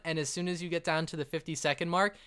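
ordinary talk

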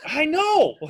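loud, high "I know!" breaking into a laugh; exclaimed agreement. Real, not acted. Host agreeing with co-host about the weather, exclaimed. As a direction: cry it out in exasperated agreement, laughing. Exasperated agreement cried out in banter.

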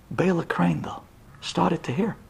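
plain reading voice

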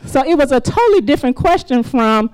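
ordinary talk

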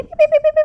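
high, loud mimicked monitor beeps; machine beep imitation. At the deathbed, imitating the hospital monitor, high beeps.

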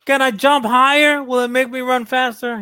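put-on high eager kid voice. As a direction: ask it like an excited kid. Acting kid trying on shoes.

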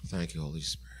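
soft, low worshipful thanks; quiet prayer. Real, not acted. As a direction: pray it softly and reverently.

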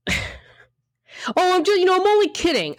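high, fast mock voice of a dismissive teaser. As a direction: sneer it out like someone brushing off a hurt. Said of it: "when they say things like".

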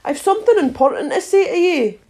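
high-pitched call; lightly called out, urging her back; calling after someone. A narrator's reading. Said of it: "the caterpillar called after her".

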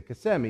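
KISSIMMEE as kuh-SEM-ee, middle syllable stressed; local Kissimmee pronunciation. Acted. Friend citing how they say it down here, Kissimmee as kuh-SEM-ee. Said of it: "That's how they say it down here".

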